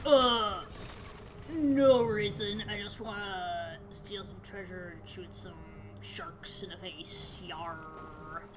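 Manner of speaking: very slow, low pirate drawl; lethargic sea captain. Acted. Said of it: "lethargic whale man than a legitimate pirate".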